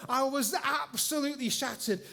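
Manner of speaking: normal talking voice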